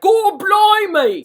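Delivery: loud, high, drawn-out Cockney yell; horrible Cockney accent. Retold or imitated. Poor characters speaking for an English audience, horrible Cockney. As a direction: belt it out in awful mock Cockney. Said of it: "with a horrible Cockney accent".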